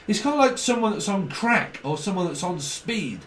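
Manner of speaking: ordinary talk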